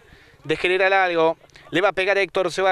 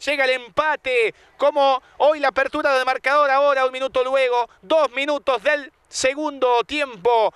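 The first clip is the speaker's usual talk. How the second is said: excited goal commentary; 5 semitones higher than usual. Real, not acted. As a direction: call the equalizer, still buzzing. Excited goal call after goal.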